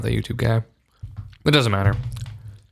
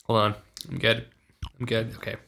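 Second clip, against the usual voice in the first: softly recovering from choking; 8 dB quieter than usual. Real, not acted. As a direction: catch your breath after choking. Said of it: "I'm choking now".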